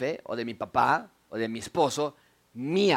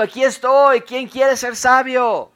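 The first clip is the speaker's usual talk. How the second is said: loud, high cry from the busy street corner; shouting wisdom; 8 semitones higher, 9 dB louder, slower than usual. A narrator's reading. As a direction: call out to the crowd, stretching the question slowly.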